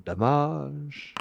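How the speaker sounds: DAMAGE said "da-MAAZH", high and drawn out; mock-French "damage". Player announcing the damage roll, high mock-French "damage". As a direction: stretch "damage" into a high, playful "da-maaazh". Same damage call repeated.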